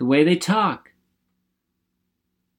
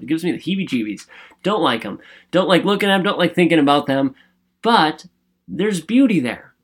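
ordinary talk, nothing marked

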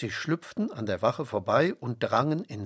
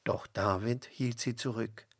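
ordinary delivery

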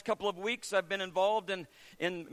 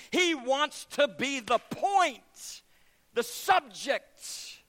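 very slow, weighty words; slow emphatic preaching; 5 semitones higher, 5 dB louder, much slower than usual. Acted. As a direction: lean on each word. Preaching climax.

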